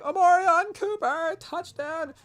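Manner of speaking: high, slow stretched announcer call with a crack; Gus Johnson call. Retold or imitated. Retelling the announcer's touchdown call, high and stretched. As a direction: imitate the excited play-by-play cry. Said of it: "Gus Johnson ... where he's like".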